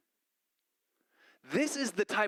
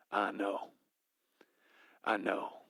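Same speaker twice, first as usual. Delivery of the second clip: lightly voiced Louisiana sympathy, lower; 7 semitones lower, slower than usual. Mentor sympathizing with her in a Louisiana accent. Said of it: "I have to inflect his voice".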